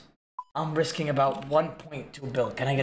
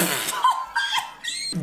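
squeaky breathless laugh in a put-on voice; giggling mockery in falsetto; high mocking cackle. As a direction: cackle at the haters, high and silly. Mock voice laughs at commenters.

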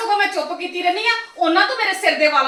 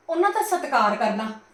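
ordinary talk, nothing marked

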